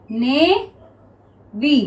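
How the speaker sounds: slow navy, split as "na - vy"; long pause between the syllables of navy. Shown as a demonstration. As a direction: stretch navy into two separate pieces. Word broken up while writing it.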